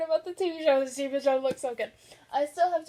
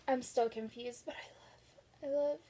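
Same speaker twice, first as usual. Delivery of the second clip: whisper; 8 dB quieter than usual. Real, not acted. Confused aside, whispered.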